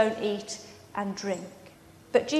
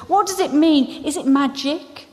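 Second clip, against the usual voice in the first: higher, urgent questioning; lightly acted bewildered dismay; distressed cry; 5 semitones higher, 4 dB louder than usual. A narrator's reading. Susan asks about the broken table, distressed questioning. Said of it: "cried Susan".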